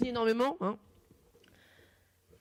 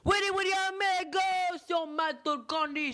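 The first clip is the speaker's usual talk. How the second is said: radio call-out; 10 semitones higher than usual. Acted. Radio host calling out intro.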